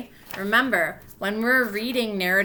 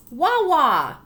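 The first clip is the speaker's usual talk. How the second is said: put-on high, louder toddler talk, slow at the end; baby voice; 6 semitones higher, 7 dB louder than usual. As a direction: say it like a proud little toddler. Baby's word for water voiced.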